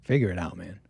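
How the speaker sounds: low, curt friend's put-down; blunt friend. Retold or imitated. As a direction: drop low and deadpan the jab. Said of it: "one of your friends is like".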